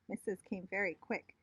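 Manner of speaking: ordinary delivery, nothing marked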